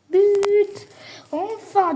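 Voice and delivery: loud high cry of triumph; sudden loud goal cheer; goal shout. Real, not acted. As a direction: yell it as the ball goes in. Scores a goal in game.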